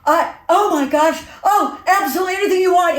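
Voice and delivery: high, fast, breathless fan; starstruck gush. Retold or imitated. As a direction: gush like you might faint. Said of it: "He said".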